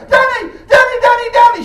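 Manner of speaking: high, loud calling out of a name; piercing call. Retold or imitated. Woman in the street calling out to the comic, high and loud. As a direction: yell the name across a busy street. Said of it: "she goes".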